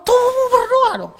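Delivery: high, pressing call to pray; excited urging. Acted. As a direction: rouse them to pray with the body. Preacher urges praying with body. (seruan bersemangat)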